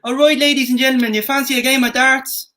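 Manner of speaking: high-pitched southern Irish voice; Southern Irish accent. Shown as a demonstration. Games host offering darts on request, Southern Irish accent. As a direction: put on a Republic of Ireland brogue. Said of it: "And Southern Ireland?".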